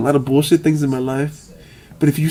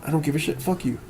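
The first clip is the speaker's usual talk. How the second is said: softer, cold brush-off; quiet dismissal; 8 dB quieter, faster than usual. Retold or imitated. Retold dismissive insult.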